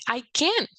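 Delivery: "can" jumping up high, then falling; stress on can. Shown as a demonstration. Teacher showing emphasis on that word, stressing "can" high. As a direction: lift "can" sharply in pitch to contradict. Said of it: "higher and put more stress in that word".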